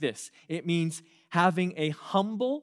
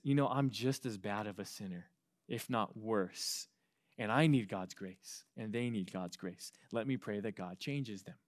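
humble inner voice; 5 semitones lower, 7 dB quieter than usual. Retold or imitated. Voicing the humble inner thought.

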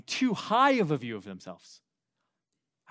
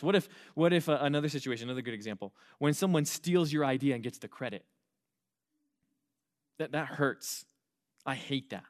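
ordinary talk

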